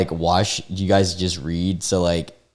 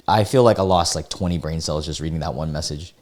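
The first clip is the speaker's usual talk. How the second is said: ordinary delivery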